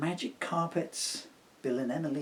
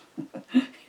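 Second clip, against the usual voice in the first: Santa chuckle; 8 dB louder than usual. Acted. Santa laughs.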